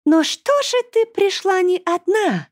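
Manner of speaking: high, slow villainess question; gloating demon singsong; taunting demoness. A narrator's reading. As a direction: stretch it out in a high mocking voice. Demoness taunts the arriving heroine.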